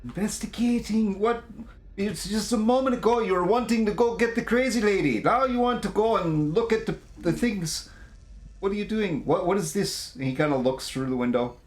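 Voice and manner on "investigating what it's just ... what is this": high, exasperated scolding; frantic NPC. Acted. Crew member berating Vincent for dawdling, high and exasperated. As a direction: hurry them along, baffled.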